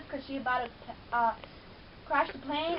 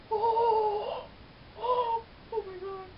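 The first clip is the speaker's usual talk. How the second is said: higher, slowed, crying; tearful cry; 7 semitones higher, much slower than usual. Real, not acted. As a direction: cry out at the sad scene. Crying at scene.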